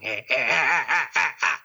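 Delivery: mock villain cackle, lightly done; evil laugh. Podcaster imitating Palpatine's laugh for his co-host, mock evil cackle. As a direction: imitate the emperor's gloating laugh. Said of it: "he starts laughing".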